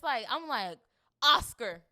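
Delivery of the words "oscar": loud one-word proclamation; emphatic shout. Real, not acted. Fan awarding the actress an Oscar, emphatic shout. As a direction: shout it out like a verdict.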